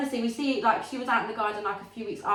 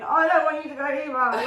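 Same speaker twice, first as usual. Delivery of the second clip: worried inner voice; 5 semitones higher, 6 dB louder than usual. Retold or imitated. Inner thought, anxious mum.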